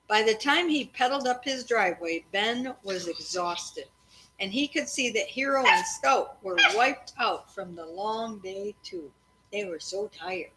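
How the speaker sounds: steady read-aloud storytelling voice; story narration. A narrator's reading. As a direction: read it evenly to the kids at bedtime. Parent reading a chapter aloud.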